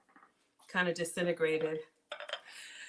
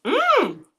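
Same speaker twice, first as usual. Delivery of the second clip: delighted mm; 14 semitones higher, 11 dB louder than usual. Real, not acted. First bite, very hot.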